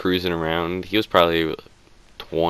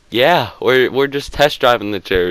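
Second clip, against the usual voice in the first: higher, louder, faux-casual reply; mock innocent excuse; 6 semitones higher, 4 dB louder than usual. Caught boy bluffing to the manager, mock innocent. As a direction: play it cool, a touch cheeky.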